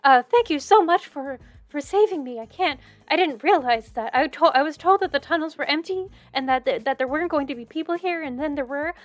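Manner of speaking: put-on high, breathless, gushing rescued girl, often fast; rescued maiden gushing thanks to her saviours; excited young woman. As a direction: babble gratefully like a flustered village girl. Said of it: "She goes".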